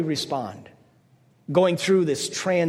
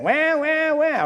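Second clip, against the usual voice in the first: high whiny mock baby wail "wah, wah"; mock crying wah; 11 semitones higher, much slower than usual. Preacher mocking complainers with a high baby wail. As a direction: cry like a spoiled baby to mock the whiners.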